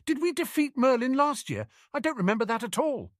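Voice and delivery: put-on high, quick puzzled boy voice; lightly voiced confused boy; Harry confused. A narrator's reading. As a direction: sound honestly baffled.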